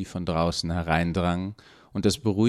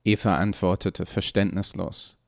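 usual speaking voice

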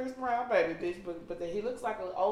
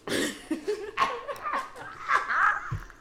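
squealing laugh; high laughter. Real, not acted. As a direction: burst out laughing. Laughing hard.

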